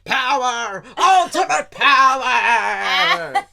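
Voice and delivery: loud, high, drawn-out villain cry; hammy Palpatine shout. Retold or imitated. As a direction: bellow it like a cackling villain. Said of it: "wakes up and goes".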